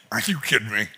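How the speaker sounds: low, soft disbelief; not in the mood. Retold or imitated. Nate brushing off excited Phil, unimpressed. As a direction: dismiss him under your voice. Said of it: "Nate is not in the mood".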